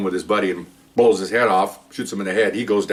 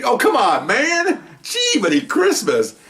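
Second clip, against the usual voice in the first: mock outrage; much slower than usual. Teller's mock protest to officer.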